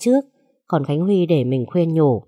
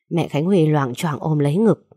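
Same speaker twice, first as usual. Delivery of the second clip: ordinary talk, nothing marked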